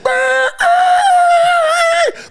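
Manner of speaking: loud high horn imitation; imitated horns, shouted high; honking car horns.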